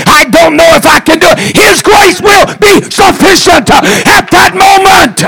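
high, shouting sermon peak; preaching climax. Acted. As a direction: lift the church with a shouted promise.

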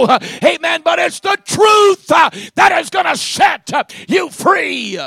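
high, shouted Pentecostal sermon delivery; full-force preaching. Real, not acted. Preacher proclaiming truth to the congregation, fervent shouting. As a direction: preach it loud, punching phrases with gasped "uh".

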